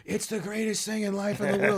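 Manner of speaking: mock gushing. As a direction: parody someone overselling the thrill. Mocks people who gush.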